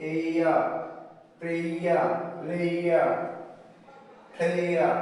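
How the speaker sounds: এইয়া, প্রেয়া, লেয়া, প্লেয়া slowly glided; triphthong breakdown. Shown as a demonstration. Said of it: "প্রত্যেকটার মধ্যে কি". (ধীরে ধীরে ভেঙে উচ্চারণ)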